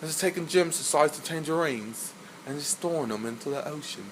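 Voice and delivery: soft British butler storytelling; put-on British accent, quietly recounting an old tale; Alfred. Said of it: "You sound like Alfred".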